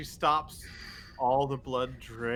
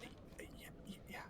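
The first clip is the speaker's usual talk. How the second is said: faint, trailing whisper; barely audible; 3 semitones higher, 12 dB quieter, much faster than usual. Acted. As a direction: mumble it almost inaudibly. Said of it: "I, I can't hear you".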